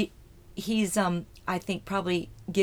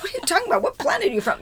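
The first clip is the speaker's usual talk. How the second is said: mock disbelief; 12 semitones higher, 4 dB louder, much faster than usual. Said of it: "people's stunned reaction retold".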